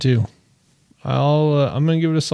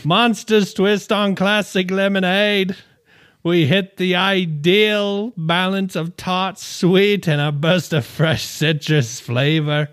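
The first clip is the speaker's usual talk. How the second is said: put-on best Australian accent; 3 semitones higher than usual. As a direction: sell the drink in a mock Aussie accent. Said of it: "Best Australian accent?".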